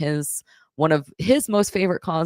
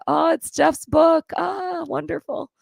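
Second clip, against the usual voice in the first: delighted; 13 semitones higher, slower than usual. Real, not acted. Shows gift from a fan.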